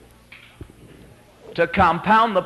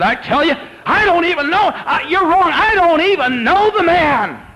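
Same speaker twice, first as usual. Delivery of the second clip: loud, high, furious shouted denial; swearing denial; 7 dB louder than usual. Acted. Peter cursing and swearing to the guard, shouted denial. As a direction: hammer it out at a shout, stretching the last words. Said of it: "He started to swear".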